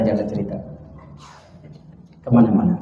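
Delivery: ordinary delivery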